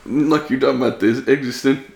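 ordinary delivery

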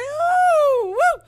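very high, loud whoop of surprise; startled thrilled shriek, pitch flying up; excited squeal. Retold or imitated. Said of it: "So I was like".

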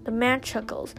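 ordinary delivery, nothing marked

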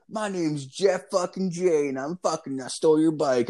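cocky bike thief. Retold or imitated. Older kid boasting to his victim in a story, cocky.

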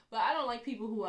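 ordinary talk

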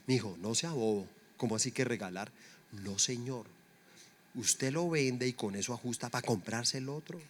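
low, soft parental scolding; imitated thrifty parent. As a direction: play a parent quietly refusing. Voices a parent advising a child.

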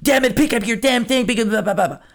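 hammed-up drill coach pushing the kid; a hint of a harsh yelling coach; pushy tough coach. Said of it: "if I was going to turn into this".

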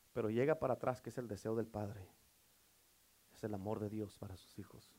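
soft, low, intimate preaching; hushed sermon close. Acted. Preacher softly urges the congregation to forgive, hushed and intimate.

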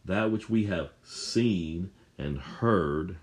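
slow, deliberate scripture reading; preacher slowing down to underline the senses; slow emphasis. Shown as a demonstration. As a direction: linger over every word to drive it home. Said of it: "Notice John is emphasizing the physical senses".

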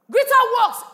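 loud, high proclamation; proclaiming shout. Real, not acted. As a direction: cry it out like a climax. Preacher's climactic proclamation.

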